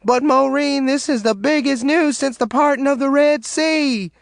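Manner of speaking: tearful shriek at Maureen. A narrator's reading. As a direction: shriek the big news through tears. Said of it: "Caddy shrieked".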